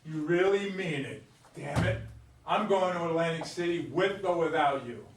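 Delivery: loud, fed-up declaration; angry outburst. Acted. Husband defies wife, loud and fed up. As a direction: slam the door on the argument.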